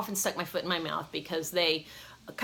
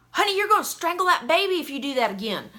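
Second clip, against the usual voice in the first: high, loud, fast, fussing country woman; country lady warning; 10 semitones higher, 6 dB louder, faster than usual. Retold or imitated. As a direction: rush out a worried warning to the pregnant girl. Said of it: "And she goes".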